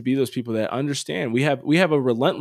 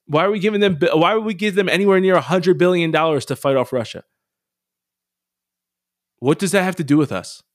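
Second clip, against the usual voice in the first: louder and higher, frustrated questions piling up; exasperated rant; 4 semitones higher, 5 dB louder than usual. Real, not acted. Host venting to listeners about Ukraine aid, exasperated and loud. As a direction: vent your disbelief at the listeners. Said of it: "sorry if I got a little pessimistic on you there".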